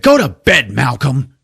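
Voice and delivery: loud, high irritated order; mock-angry shout at a patient; exasperated yell. Doctor sending his patient to bed, exasperated shout.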